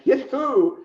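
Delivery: high, loud startled question; mock-shocked captain. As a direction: shoot back in disbelief. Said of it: "I'm like".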